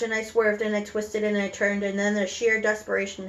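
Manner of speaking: plain reading voice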